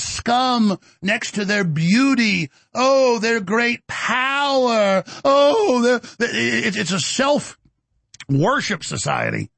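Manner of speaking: slow, high, fawning mock-sob voice; mock flattery. Mocking how to flatter his opponents, slow fake-sobbing praise. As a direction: gush with fake reverence, drawn out. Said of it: "I should always just speak like this to them".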